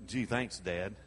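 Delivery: sarcastic thanks. Acted. Sarcastic aside about father.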